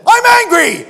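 high, loud re-enacted anger; angry outburst. Client answering counselor about his feelings, re-enacted angry shout. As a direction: blow up at the counselor, hammed up. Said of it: "I was just so angry".

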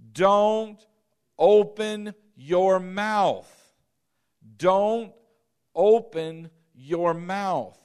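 each word pressed out slowly, loud; slow emphatic command. Acted. Preacher voicing Jesus to Jairus, slow emphatic command.

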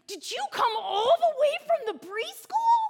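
high, loud, drawn-out question in wonder; slow, high-pitched disbelief; amazed child. Retold or imitated. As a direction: imitate an astonished preschooler. Imitates amazed preschooler's question.

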